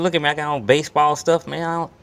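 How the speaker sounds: put-on high, fast, breathless babble of a starstruck kid; retold nervous excitement. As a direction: gush it out quickly like a nervous fan. Starstruck teen meeting his idol.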